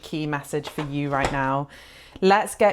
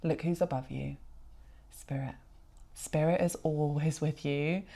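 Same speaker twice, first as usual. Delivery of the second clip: hushed reverence; 9 dB quieter than usual. Real, not acted. Sees Spirit above viewer.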